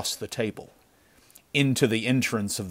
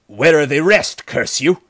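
loud, higher-pitched furious question; angry demand; 6 semitones higher, 9 dB louder than usual. A narrator's reading. Chief questioning his wounded man, angry and loud. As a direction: demand an answer at full voice.

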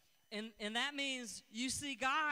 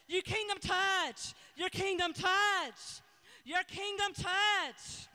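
loud, rhythmic prayer with Lord at each line end; fervent prayer; 2 semitones higher, 4 dB louder than usual. Acted. Pastor praying over the nation with listeners, fervent prayer. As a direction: pray it out with fervour.

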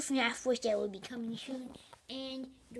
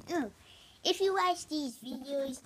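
ordinary delivery

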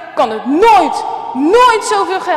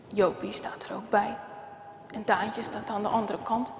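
plain reading voice

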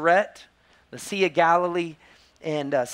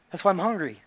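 sudden realization; 3 semitones higher, faster than usual. Retold or imitated. Mom realizes she skipped meals.

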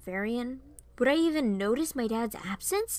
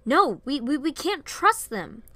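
raised, stammering protest; agitated refusal; 6 semitones higher, 6 dB louder than usual. A narrator's reading. Varian refuses to tell the royal family, agitated. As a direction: stumble over a louder no.

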